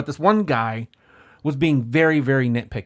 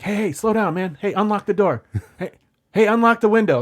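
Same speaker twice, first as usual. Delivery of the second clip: retold shout to a driver, raised pitch; calling out; 7 semitones higher, 4 dB louder than usual. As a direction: imitate an urgent, higher-pitched call. Retold kids shouting from limo.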